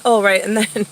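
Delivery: ordinary talk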